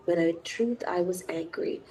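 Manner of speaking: hushed confessing whisper. Acted. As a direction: let the admission slip out softly. Confession read softly.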